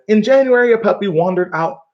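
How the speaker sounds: reading aloud. Shown as a demonstration. Teacher reading the story aloud to class, lively. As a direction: read the story's first words with lift. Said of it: "it says".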